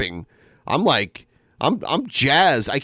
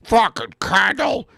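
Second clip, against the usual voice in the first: imitating angry dad; 5 semitones higher than usual. Mocking Kendall's dad again.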